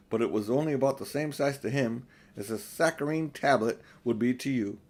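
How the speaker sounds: usual speaking voice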